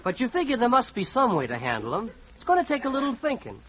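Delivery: ordinary talk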